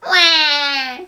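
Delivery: loud mock baby cry; baby wail. Imitating the crying baby.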